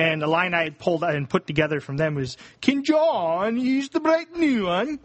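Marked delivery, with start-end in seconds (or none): 2.6-5.0 slow, high, swooping Welsh lilt; sing-song Welsh accent. Shown as a demonstration. Podcaster demonstrating a coach's line, sing-song Welsh. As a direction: let the Welsh pitch go up and down. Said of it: "it's kinda like sing-song".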